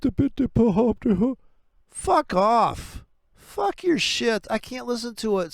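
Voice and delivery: real angry yelling tirade; furious rant. Real, not acted. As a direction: let the rage boil over. Ranting against universities and pipelines.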